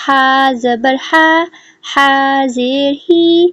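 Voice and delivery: slow, drawn-out syllable drill; slow drill reading. Shown as a demonstration. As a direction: read each sound slowly and clearly like a Qaida teacher. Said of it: "اس کو ہم ریڈ کریں گے".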